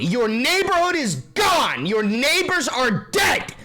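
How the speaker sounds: slow, high, loud near-crying lament; anguished outcry. Acted. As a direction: cry out each loss slowly, voice breaking. Gaza prisoners returning to ruins.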